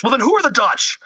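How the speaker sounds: high, whiny George Costanza; Costanza quote. Retold or imitated. Seinfeld joke retold, high mock George whine. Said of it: "George Costanza goes".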